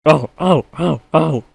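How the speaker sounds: high, loud squealing trucks; crying trucks. A narrator's reading. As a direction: shriek like jostled trucks. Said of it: "they cry again".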